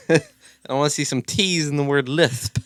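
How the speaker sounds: ordinary delivery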